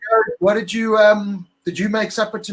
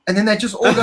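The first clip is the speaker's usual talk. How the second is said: ordinary talk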